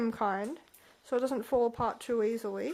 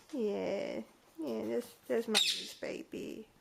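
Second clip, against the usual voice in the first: soft, slow murmur; soothing coo; 4 dB quieter, slower than usual. Real, not acted. Owner softly soothing her bird, slow coo.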